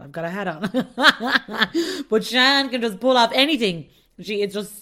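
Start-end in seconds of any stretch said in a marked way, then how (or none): 0.0-2.0 slow, stretched, laughing; drawn-out mock. Host mimics herself wearing a hat, slow laughing drawl. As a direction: drag it out slowly, laughing. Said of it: "I'll just walk around like".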